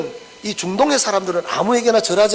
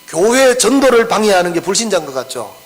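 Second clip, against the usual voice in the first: ordinary talk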